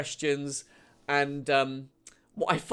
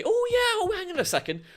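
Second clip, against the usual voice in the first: chirpy agent voice; 10 semitones higher than usual. Retold or imitated. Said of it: "they will then say".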